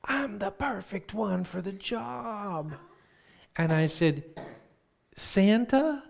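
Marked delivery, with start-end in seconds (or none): 0.0-2.7 high, slow, stretched-out proclamation; imitating eager brother. Brother pitches himself as Santa's successor, eagerly proclaiming. 5.1-6.0 very high, loud, rising question; astonished. Retold or imitated. Sister realises brother applied to be Santa, loudly incredulous.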